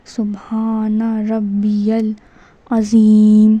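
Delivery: very slow, drawn-out سبحان ربی العظیم; correct recitation. Shown as a demonstration. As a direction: model سبحان ربی العظیم with "rabbi-yal" and long vowels. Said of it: "تو ہم کو کیا پڑھنا ہے".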